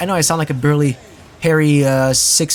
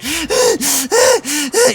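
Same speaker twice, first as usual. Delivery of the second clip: exaggerated high gasps, mocking a tantrum; hammed-up out-of-breath gasping; huffing and puffing; 13 semitones higher than usual. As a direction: imitate someone heaving for breath. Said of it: "like, huffing and puffing".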